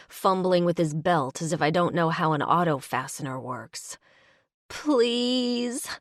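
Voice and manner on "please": high, pitiful whine; whiny plea. Pretend. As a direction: whine it like a sorry child. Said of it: "I whine in my best sorry voice".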